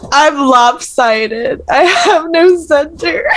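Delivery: high, drawn-out comic whine; mock wail. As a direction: wail it slowly like a pretend meltdown. Mock wail when told to centre.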